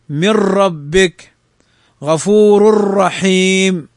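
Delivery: slow recitation with the ر in ربك and رحيم doubled and held; stressed doubled r. Shown as a demonstration. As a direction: lean on a long doubled ر in "من ربك" and "غفور رحيم". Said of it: "الراء في الإدغام تشدد".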